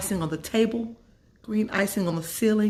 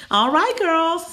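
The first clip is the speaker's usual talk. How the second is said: Mom calling out; 11 semitones higher, 5 dB louder, slower than usual. Acted.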